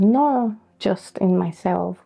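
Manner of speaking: usual speaking voice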